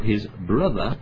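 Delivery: "brother" stressed at the end of the phrase. Real, not acted. Preacher quoting scripture to the congregation, stress on "brother". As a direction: lean on the word "brother". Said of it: "I emphasize the word brother".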